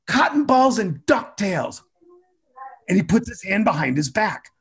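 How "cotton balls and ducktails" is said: put-on slow, higher kid chant; higher, slow recital of a class rule; kid reciting.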